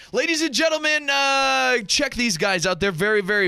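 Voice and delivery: "ladies and gentlemen" called out high like a ringmaster; circus master. Shown as a demonstration. Radio host introducing comedian guests, high showy ringmaster call. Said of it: "I feel like I'm a circus master".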